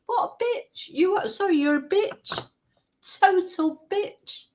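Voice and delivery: high-pitched, indignant name-calling; angry. Real, not acted. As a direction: spit out the insult in real outrage, pitched high. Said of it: "I've got too angry now".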